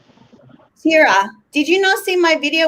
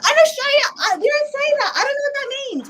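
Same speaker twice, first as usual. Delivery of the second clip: higher, fast, puzzled voice; retelling her own words; 10 semitones higher, faster than usual. Retold or imitated. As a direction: replay your confused question, higher. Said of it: "I had asked my husband".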